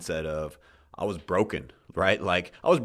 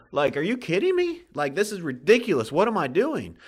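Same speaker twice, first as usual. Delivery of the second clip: much higher and louder, fed up with it all; exasperated outburst; 10 semitones higher, 5 dB louder than usual. Retold or imitated. Podcast host reliving day fifty of the challenge, exasperated high outburst. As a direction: throw your hands up, half laughing at the madness. Recalling wanting to quit, outburst.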